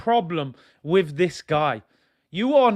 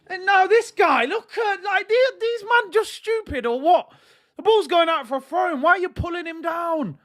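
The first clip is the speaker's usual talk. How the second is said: real exasperated shouting; 12 semitones higher than usual. Real, not acted. Fan yelling at Arsenal players pulling opponents down, angry shout. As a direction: yell in disbelief at the players.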